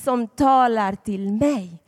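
put-on slow, deliberate words for children to repeat; slow teaching recital. Shown as a demonstration. Preacher teaching children a slow, deliberate recital. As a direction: recite slowly so the kids can follow. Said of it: "Säg det med mig".

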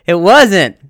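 sudden loud denial, half laughing. Real, not acted. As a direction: shout the no at yourself. Answers his own question about the joke.

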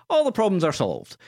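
grand decree. Retold or imitated. Simon Pegg waves hand, decrees.

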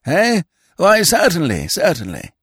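put-on high, fluttery voice of a vague old lord; a dithering aristocrat caught off guard, pitched high; flustered old earl. Vague old earl startled by his secretary, high and flustered.

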